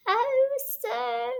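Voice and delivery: high, tearful mock washerwoman voice; crying washerwoman Toad. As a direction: whimper it as Toad in disguise. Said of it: "crying afresh".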